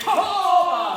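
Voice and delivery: huge high shout of surprise; loud exclamation. Real, not acted. Instructor reacting to a student's throw, loud shout. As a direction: let it burst out.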